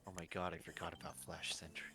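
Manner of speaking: ordinary delivery, nothing marked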